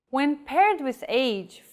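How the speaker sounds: ordinary talk, nothing marked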